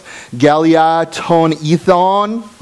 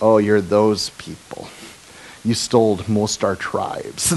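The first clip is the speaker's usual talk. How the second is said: mock scorn; 4 semitones lower, 6 dB quieter than usual. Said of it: "That's how they viewed them".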